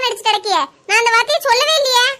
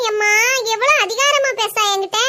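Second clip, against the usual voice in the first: ordinary talk, nothing marked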